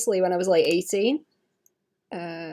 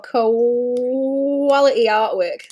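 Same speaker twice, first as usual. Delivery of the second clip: mock praise; 4 dB louder, much slower than usual. Real, not acted. Mocking own old drawing.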